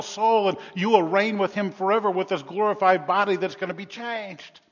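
loud, high, driving sermon voice; full-force preaching. Acted. Pastor rousing the congregation about false hope, preaching loud.

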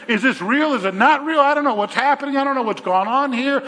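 a man unsure he's awake, voice climbing; bewildered Peter. Acted. Peter confused, unsure if real.

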